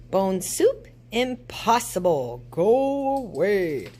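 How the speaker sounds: loud ghostly dismissal; ghost calling out. A narrator's reading. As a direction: send the witches off like a ghost. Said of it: "called the ghost".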